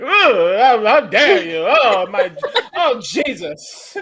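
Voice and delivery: put-on high, swooping fancy old-lady voice. Co-host imagining how a fancy lady would talk, scandalized old-lady voice. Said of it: "like a fancy old lady would talk".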